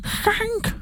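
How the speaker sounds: high startled call of Hank. Real, not acted. Calling out at noise.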